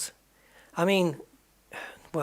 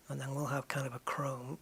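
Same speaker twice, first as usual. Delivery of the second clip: soft unvoiced whisper while thinking; 5 dB quieter than usual. Real, not acted. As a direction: half-whisper the plan to yourself. Planning paint to himself.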